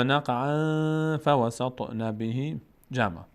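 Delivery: slow chanted نقعاً, long nasal into فوسطن; nasal ikhfa. Shown as a demonstration. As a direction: hold the n of نقعاً in the nose, then recite on. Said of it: "این حکایت در این آیه".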